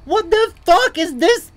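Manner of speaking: high, loud, slow outcry; exasperated. Real, not acted. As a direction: stretch it out in frustrated disbelief. Venting at unexplained game deaths.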